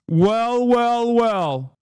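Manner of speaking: high, loud mock surprise; sarcastic shock. Mocking poll skeptics to chat with loud fake shock. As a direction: feign being stunned, dripping sarcasm.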